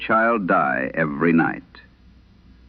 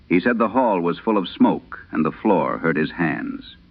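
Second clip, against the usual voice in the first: ordinary delivery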